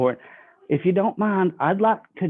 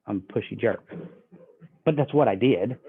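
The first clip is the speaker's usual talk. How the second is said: ordinary delivery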